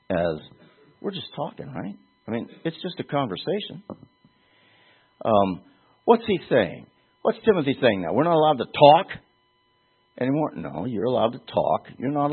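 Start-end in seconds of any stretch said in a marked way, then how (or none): 1.0-3.8 soft, higher mock-innocent tone; feigned casual innocence. Slanderer passing talk off as conversation, feigned innocence. 8.1-10.5 higher-pitched protesting question; mock objection. Objector answering a warning about slander, mock protest.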